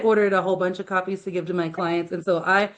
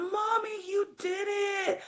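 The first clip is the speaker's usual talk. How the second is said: put-on much higher, softer, tearful kid voice; imitating crying son; 9 semitones higher, 5 dB quieter than usual. Mom imitating her son breaking down in tears, high and soft. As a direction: sob it out like an overwhelmed little boy. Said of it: "ugly cry".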